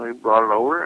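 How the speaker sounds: usual speaking voice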